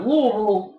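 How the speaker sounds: "Louisville" run together as "LOO-uh-vul"; native Louisville pronunciation. Shown as a demonstration. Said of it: "you know they're native Kentuckian".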